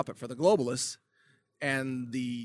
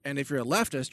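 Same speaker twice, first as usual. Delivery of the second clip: normal talking voice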